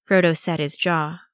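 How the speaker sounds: ordinary delivery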